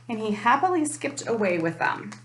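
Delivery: usual speaking voice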